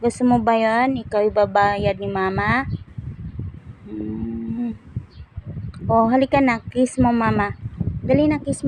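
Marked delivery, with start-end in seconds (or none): none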